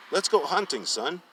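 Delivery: high, loud hearty invite; father's cheery call. Acted. Father calling his son to hunt, high and loud. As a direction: call out like an eager old dad.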